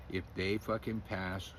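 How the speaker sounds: ordinary talk, nothing marked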